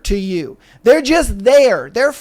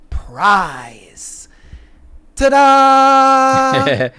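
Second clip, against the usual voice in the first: showman reveal; 4 semitones higher, much slower than usual. Acted. Punchline reveal flourish.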